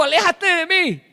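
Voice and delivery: high, loud pleading cry; Peter's plea. Acted. Peter begging the Lord to leave, high loud cry. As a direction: beg him to go away. Peter pushing Jesus away.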